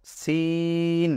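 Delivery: "সিন" with a long, level "ee"; model pronunciation. Shown as a demonstration. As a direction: stretch the vowel of "সিন" slowly and evenly. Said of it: "কিভাবে উচ্চারণ করব?".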